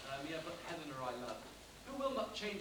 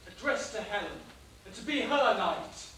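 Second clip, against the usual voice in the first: rising vow; 2 semitones higher, 9 dB louder than usual. Acted. Lysander's vow.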